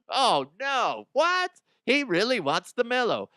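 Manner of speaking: high-pitched hammed-up disbelief; mock shock. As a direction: react with fake radio-bit alarm. Acted co-host reaction.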